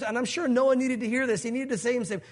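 normal talking voice

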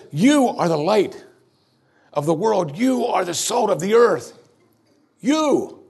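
high, ringing "You are the light", final "You" soaring; emphatic quote. Acted. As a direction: preach it as Jesus's own declaration. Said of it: "He says".

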